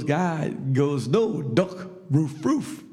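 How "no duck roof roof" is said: imitated Bulgarian accent with dog barks. Bulgarian man corrects the buddy, barking in a thick accent. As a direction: correct him in Bulgarian-accented English, then woof. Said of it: "And the Bulgarian goes".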